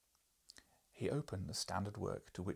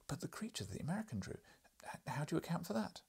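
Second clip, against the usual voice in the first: eager journalist; 8 semitones higher, faster than usual. Acted. Journalist asks.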